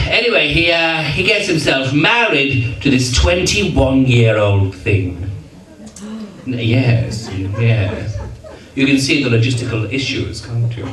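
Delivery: ordinary talk, nothing marked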